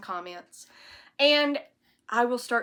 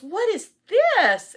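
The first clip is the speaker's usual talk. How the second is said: high, slow, loud curious question; Penny peering at a strange gadget; 13 semitones higher, 12 dB louder, much slower than usual. Retold or imitated. Quoting Penny, high, slow and loud. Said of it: "she goes".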